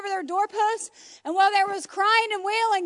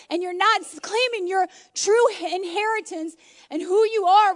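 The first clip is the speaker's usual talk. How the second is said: loud, rising preacher's cry, slowing on the key words; full-force preaching; 2 semitones higher, 4 dB louder than usual. Acted. Preacher to congregation on sin washed away, impassioned preaching. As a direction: preach it to the back row. Preaching you are a saint.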